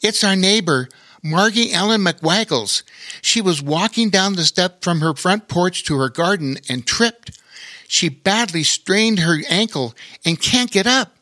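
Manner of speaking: put-on urgent kid explaining; young boy voice. A narrator's reading.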